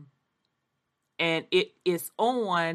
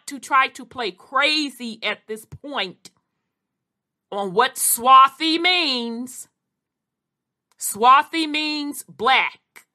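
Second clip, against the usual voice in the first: pointed, emphatic; 4 semitones higher, 6 dB louder than usual. Real, not acted. Lecturing those who play dumb.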